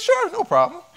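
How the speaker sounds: high, bright-voiced mock politeness; hammed-up eager to help; obliging reply. Doctor agreeing to a patient's request to empty the trash, high and mock-cheerful. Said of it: "I said".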